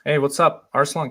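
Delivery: ordinary talk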